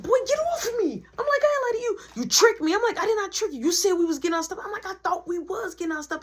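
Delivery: high-pitched bickering; squeaky arguing brothers. Retold or imitated. Two brothers argue about who tricked whom, squeaky.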